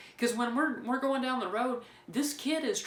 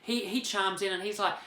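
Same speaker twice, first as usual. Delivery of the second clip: usual speaking voice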